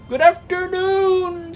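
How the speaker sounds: high, loud, slow goodbye; curt dismissal. Acted. Scrooge dismisses his nephew loudly and slowly. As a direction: shut the talk down. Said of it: "said Scrooge".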